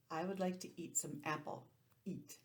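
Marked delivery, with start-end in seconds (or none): none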